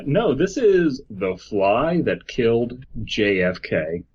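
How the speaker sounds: ordinary talk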